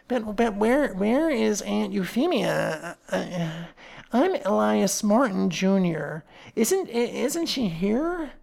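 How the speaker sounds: slow, halting, nervous stammer; stammering nephew. Acted. Worried nephew asking where his aunt is, halting and slow. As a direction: stumble anxiously over the words.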